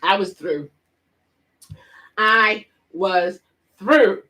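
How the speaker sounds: slow, stretched through; drawn-out exasperation. Real, not acted. Scene: vlogger done with laughing, slow and drawn out. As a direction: drag it out in disbelief.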